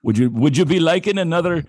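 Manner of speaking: a pub barman's Irish lilt, played for laughs; slipped into Irish. Podcast host mocks YouTube accent practice in Irish accent. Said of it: "sorry, I went Irish".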